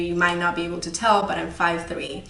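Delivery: ordinary delivery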